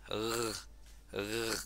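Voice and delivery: R shown alone as a throaty French-style r; R creolo alla francese. Shown as a demonstration. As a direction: sound out R like a French r. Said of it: "en criollo se pronuncia así".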